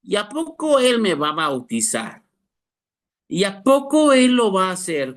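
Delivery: high, scoffing disbelief; scornful doubter. Retold or imitated. Preacher mimics proud churchgoers doubting a minister, mocking. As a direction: sneer at the idea of him baptizing you. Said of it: "porque a veces nosotros somos".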